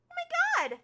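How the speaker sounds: very high, loud squeal of joy; ecstatic shriek. Acted. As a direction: squeal it at the top of the voice. Finley overjoyed at the news.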